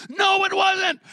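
loud, high-pitched outraged yell; full-voiced yell of correction. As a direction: shout it indignantly at the television. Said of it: "I was yelling at the T V".

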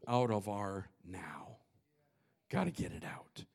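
breathy whispered urging. Real, not acted. Preacher to congregation, whispered urging. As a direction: drop to a whisper, lean in.

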